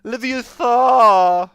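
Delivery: loud, high, drawn-out mock correction; Hermione. Gamer mocking a word correction, as Hermione. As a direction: stretch the last syllables in a hammed-up scolding. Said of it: "Fucking Hermione".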